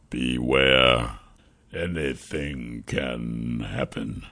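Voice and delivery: deep, slow creature voice; grave cursed king. Acted. Cursed king warns the hero, deep slow grave voice. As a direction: warn slowly and low, with weight.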